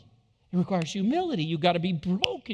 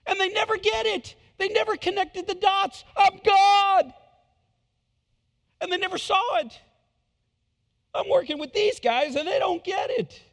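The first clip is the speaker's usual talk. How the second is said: high, loud, incredulous rant; imagined Jesus venting to himself, high and loud; exasperated Jesus; 13 semitones higher, 4 dB louder than usual. Pretend. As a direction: vent about the clueless disciples, half shouting. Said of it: "I could just see him sitting there saying".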